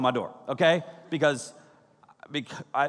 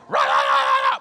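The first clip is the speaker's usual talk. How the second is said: dogs barking. Retold or imitated. Two dogs barking wildly at the door. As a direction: bark like frantic dogs. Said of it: "The dogs".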